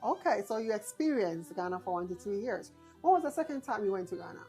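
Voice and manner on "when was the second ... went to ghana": ordinary talk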